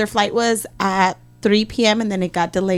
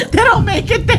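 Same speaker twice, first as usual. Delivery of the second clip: laughing; 12 semitones higher than usual. Real, not acted. Mocking the Angels, laughing.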